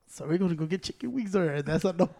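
low, very quiet mumbled suggestion; hushed impression of a timid friend; Cedar. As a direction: imitate him softly, under your breath. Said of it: "Cedar just goes like this".

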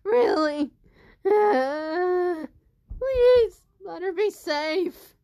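loud, high, tearful plea; crying prayer. Acted. Naomi tearfully prays aloud for Nancy, loud and high. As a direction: pray for her safety through sobs. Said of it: "Naomi was like".

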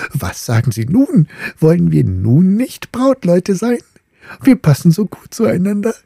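high, eager suitor voice, a little rushed at first; eager courting top. Acted. The top proposing to the little ball, high and eager. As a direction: play the top as a keen young suitor.

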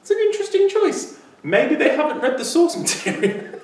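very high, sniffy voice; hammed-up high snooty verdict on a casting choice; mock judging panellist. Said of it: "where you go".

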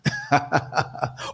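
quick, rhythmic burst of laughter; amused laugh. Real, not acted. New Yorker laughing that the accent tells on them, amused laugh. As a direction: laugh at being found out.

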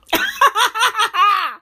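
high-pitched burst of laughter. Real, not acted. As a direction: laugh high in a quick run. Laughs at own twang joke.

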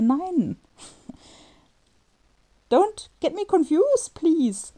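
high, slow, drawn-out plea; plead, half laughing, to stop the mix-up; exasperated plea. Retold or imitated. Exasperated correction of operator.

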